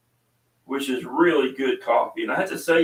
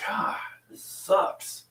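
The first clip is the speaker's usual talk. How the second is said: slow whisper of pain; whispered inner groan; 5 dB quieter, much slower than usual. Retold or imitated. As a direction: breathe it out like a private complaint. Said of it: "And it's like".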